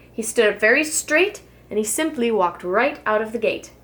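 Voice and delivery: usual speaking voice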